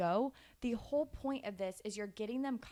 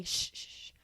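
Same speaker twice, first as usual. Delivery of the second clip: voiceless soothing shh; mother shushing her toddler to hear the pee; whispered shush. Retold or imitated. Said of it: "And I would whisper in her ear".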